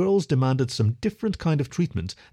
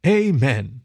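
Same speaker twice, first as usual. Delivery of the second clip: louder, drawn-out echoed amen; group echo; 2 semitones higher, 5 dB louder than usual. A narrator's reading. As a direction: echo it like a whole solemn committee. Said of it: "The gathered committee echoed that last word".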